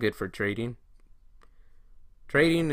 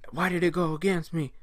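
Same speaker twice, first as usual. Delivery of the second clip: higher-pitched mock complaint; put-on higher voice of grievance; mock upset whine; 7 semitones higher than usual. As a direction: complain like a mad trader blaming the market. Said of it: "now you're mad. Now you're blaming".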